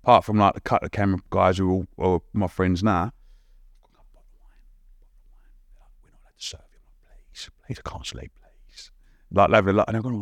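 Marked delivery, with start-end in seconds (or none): 3.8-6.8 barely audible whispered refusal. Retold or imitated. Cabin crew refusing him wine on a night flight, whispering. 7.3-8.9 tiny hushed begging voice; whispered pleading. Retold or imitated. Nervous flyer begging crew for wine, whispering. Begs drinks while others sleep.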